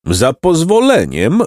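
slow, high-pitched interruption; donkey roar. A narrator's reading. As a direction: draw it out as a mild indignant roar. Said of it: "ryknął Osioł".

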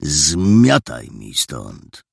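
very slow, low warning; low, menacing dismissal; stern sergeant. A narrator's reading. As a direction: order the boy off, drawing out each word. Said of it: "Surowym spojrzeniem zmierzył chłopca".

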